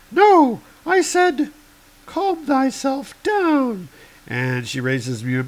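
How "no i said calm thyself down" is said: much higher heroic woman; high woman's voice. Acted. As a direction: lift the voice high for Girl Thor. Girl Thor voiced.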